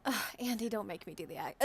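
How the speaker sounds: normal talking voice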